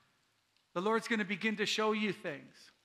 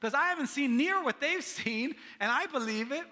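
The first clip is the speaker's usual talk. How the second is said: playfully exasperated, pitched higher; higher, worked-up complaint; frustrated; 5 semitones higher, 3 dB louder than usual. Pretend. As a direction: protest in mock frustration. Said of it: "now I'm getting frustrated".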